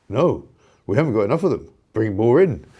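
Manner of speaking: host lightly mimicking Burnham's imagined reply; mock Burnham. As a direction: lightly parody him, brisk and dismissive. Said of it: "But Burnham thinks".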